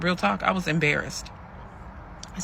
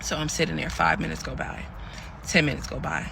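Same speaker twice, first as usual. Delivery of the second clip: ordinary talk, nothing marked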